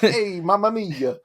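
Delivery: cheesy mock Italian accent; shitty Italian accent. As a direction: ham up a cartoon Italian accent. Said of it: "exactly that shitty Italian accent".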